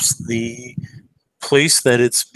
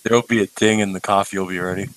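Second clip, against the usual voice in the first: ordinary talk, nothing marked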